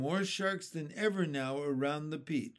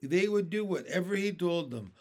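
ordinary delivery, nothing marked

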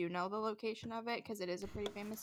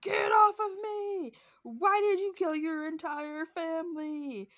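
high, loud, drawn-out shout; yelling; 7 semitones higher, 7 dB louder than usual. Retold or imitated. Demonologist demanding answers from the spirit, yelling. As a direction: act out a furious yell at a spirit. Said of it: "just starts yelling, and he's like".

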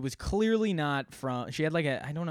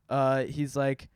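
normal talking voice

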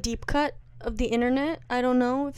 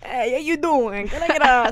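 put-on New Jersey Italian accent; 3 dB louder than usual. Co-host slipping into the video impression, New Jersey Italian accent. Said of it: "New Jersey slash New York Italians".

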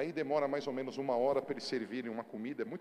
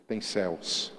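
ordinary talk

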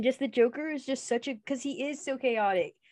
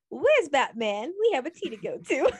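a hint of a louder, laughing Joker; mock Joker; 5 semitones higher, 5 dB louder than usual. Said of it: "He's like".

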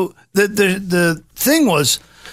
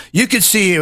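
ordinary talk, nothing marked

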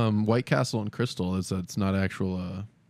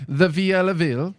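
put-on Italian accent, higher pitch; 6 semitones higher than usual. Shown as a demonstration. Friend asked for an Italian reading of the list, mock Italian accent. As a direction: read the place name like a hammed-up Italian. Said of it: "say it in an Italian accent".

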